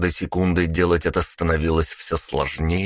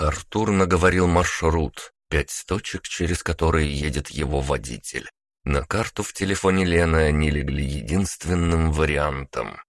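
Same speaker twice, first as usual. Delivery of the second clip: plain reading voice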